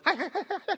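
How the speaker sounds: mock high coyote yelps; imitated coyote yips. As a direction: yip like a pack of coyotes. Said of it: "And they go".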